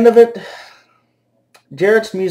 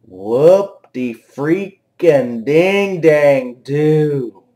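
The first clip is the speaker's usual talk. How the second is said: very slow, drawn-out mock cheer; slow sarcastic drawl; sarcastic indifference; 3 semitones lower, much slower than usual. Real, not acted. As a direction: stretch every syllable, utterly unimpressed. Sarcastic reply to promo line.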